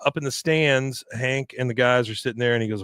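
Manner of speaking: normal talking voice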